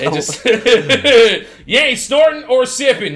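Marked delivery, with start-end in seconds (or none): 1.7-3.1 put-on higher-pitched comic country accent; mock backwoods American accent. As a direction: scold in a put-on rural accent. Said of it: "What's that accent?".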